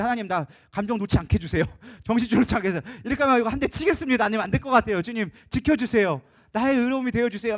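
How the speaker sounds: loud, urgent plea to God, re-enacted; exasperated prayer. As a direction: beg for help before you lose your temper. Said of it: "막 짜증이 막 이만큼 오는데". (짜증을 참으며 다급히 부르짖는 기도)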